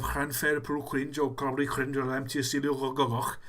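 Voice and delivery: "Llanfairpwll" and the rest with throaty Welsh ch sounds; "Gogoch" ending on a Welsh throat ch; attempted Welsh pronunciation. Shown as a demonstration. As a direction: rattle through "Llanfairpwll" to "Gogoch" in butchered Welsh. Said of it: "that's how you do it in Welsh".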